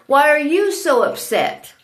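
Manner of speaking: ordinary delivery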